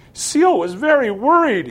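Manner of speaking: put-on a little boy crying to Sarah on her return. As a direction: let it burst out tearfully. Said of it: "he cried".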